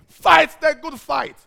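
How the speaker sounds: slow, loud preacher's cry; preaching shout. Acted. Preacher rallying the church to keep fighting. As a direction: hammer it home from the pulpit.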